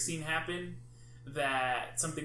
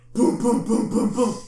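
booming sound effects, much louder and higher; loud imitated bangs; 6 semitones higher, 13 dB louder than usual. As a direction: slam out each boom like a jump scare.

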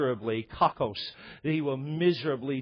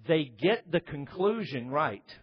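"they get the conclusion right" slow, stress on "get"; slow deliberate statement; 2 semitones higher than usual. Acted. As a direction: lay it down slowly, word by word. Repeats phrase for emphasis.

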